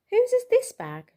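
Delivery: high loud teacher question. A narrator's reading. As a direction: ask the class brightly and loud. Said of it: "said the teacher".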